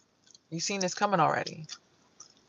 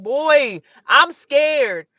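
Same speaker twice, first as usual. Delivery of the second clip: higher, louder frightened plea; mock scared plea; 5 semitones higher, 5 dB louder than usual. Woman on the four-wheeler pleading with the driver, mock scared. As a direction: beg him to stop the wheelie. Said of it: "I'm like".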